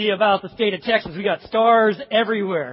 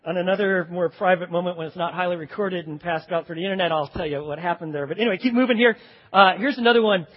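ordinary talk

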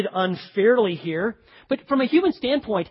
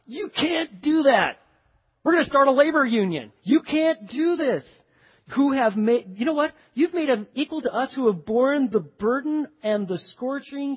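raging rant; 2 semitones higher than usual. Acted. Unpaid-feeling workers confronting the landowner, ranting. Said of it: "You can just hear 'em raging and ranting and raving".

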